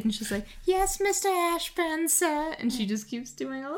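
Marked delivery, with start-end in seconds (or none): 0.6-2.5 high, slow mock sweep's voice; eager stage underling. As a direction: play the obedient worker in a hammed-up high voice. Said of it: "she runs across the stage, and she's like".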